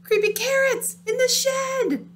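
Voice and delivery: high, loud, frantic warning; terrified yell. Acted. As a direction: scream it out, scared stiff. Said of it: "Jasper screamed".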